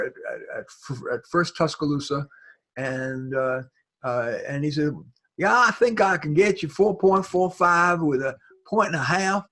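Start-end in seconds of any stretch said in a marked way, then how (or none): 5.4-9.5 mock Southern accent. Lender on the phone, in a lightly put-on Southern accent. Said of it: "I love your accent".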